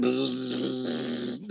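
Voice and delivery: soft buzzing zap noise; a quiet imitated electric zap. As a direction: make the zapping sound of the implant. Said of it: "here's this poor lady going".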